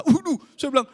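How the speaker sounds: loud, high mock alarm; re-enacted shocked outburst; appalled recoil. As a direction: exclaim in comic horror. Recoils from a confessed kiss dream.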